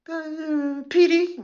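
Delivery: high, mocking arrestee voice; shrill arrestee. Comic mimics an arrestee demanding a lawyer, high mocking voice. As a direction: squeal for a lawyer like a spoiled detainee. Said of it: "call their bluff".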